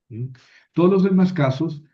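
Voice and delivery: usual speaking voice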